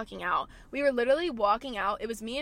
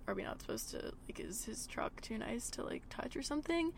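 much softer, anxious whispering; worried whisper; 2 semitones higher, 11 dB quieter than usual. Retold or imitated. Confused girl wonders about the truck, whispering nervously. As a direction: fret under your breath. Retold worried inner thoughts.

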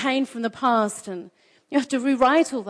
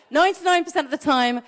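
ordinary delivery

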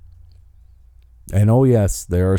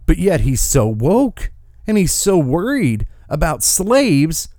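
usual speaking voice

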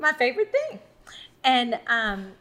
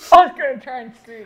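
laughing, loud and quick; 3 semitones higher, 11 dB louder, faster than usual. Real, not acted. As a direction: burst out laughing while talking. Laughing during the game.

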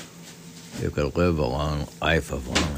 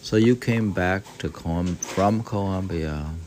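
normal talking voice

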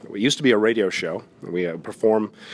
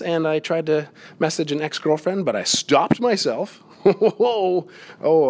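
ordinary talk

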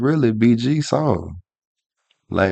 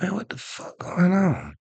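pained disbelief; 11 semitones higher, 3 dB quieter than usual. Retold or imitated. Retelling his back pain.

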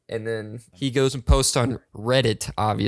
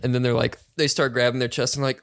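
normal talking voice

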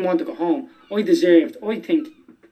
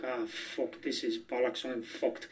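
muttered to himself; 5 semitones lower, 16 dB quieter, slower than usual. Retold or imitated. Inner panic at the interview.

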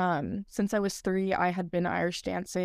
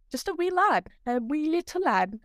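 put-on a playful, much higher stab at an Irish accent; attempted Irish accent; 8 semitones higher than usual. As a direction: try an Irish brogue and miss on purpose. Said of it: "I can't do an Irish accent".

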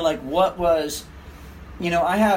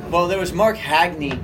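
usual speaking voice

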